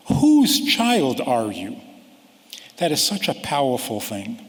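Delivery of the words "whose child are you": ordinary talk, nothing marked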